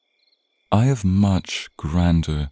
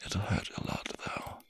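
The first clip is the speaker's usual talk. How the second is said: breathy whisper, much softer; whispered confession of the pain to come; sinister whisper; 14 dB quieter than usual. Acted. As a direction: let the truth slip out in a hush. Sinister aside to the captive.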